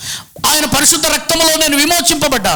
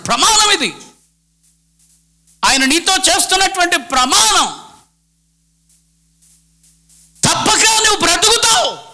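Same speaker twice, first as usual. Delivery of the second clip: higher, ringing sermon voice; fervent preaching; 7 semitones higher than usual. Acted. Pastor assuring the congregation of God's promise, fervently. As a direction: proclaim it like a sacred promise.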